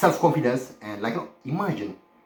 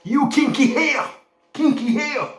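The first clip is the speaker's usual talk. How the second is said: high, loud mocking jeer at someone's hair; retold angry taunt; 12 semitones higher, 5 dB louder than usual. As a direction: fling the insult back at him, much higher and louder. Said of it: "when I was pissed off and angry".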